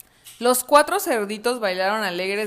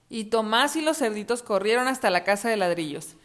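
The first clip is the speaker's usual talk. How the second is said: usual speaking voice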